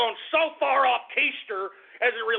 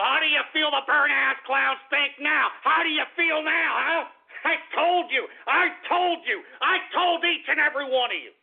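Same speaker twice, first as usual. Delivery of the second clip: angry gloating; 6 semitones higher than usual. Real, not acted. Host gloating at Sanders supporters, angry I-told-you-so. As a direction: rub it in, pitched up and heated.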